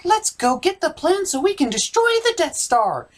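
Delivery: rebel cheering, pitched well up; eager rebel shouting the plan; high rebel voice. Acted. Rebel characters voiced in parody.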